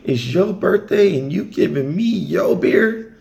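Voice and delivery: high, loud amazed question; mock disbelief. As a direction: act stunned at the kindness. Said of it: "I'm like".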